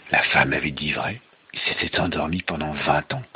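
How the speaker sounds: low, measured storytelling revelation; low and grave. Acted. Father revealing Rip's long sleep, low and grave. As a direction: drop the voice for the revelation.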